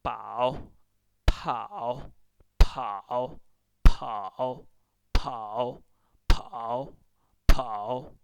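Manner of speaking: scoop down and up on "跑" with a puff of air; emphatic repeat-after-me "跑"; tone drill. Shown as a demonstration. Teacher drilling a tone word.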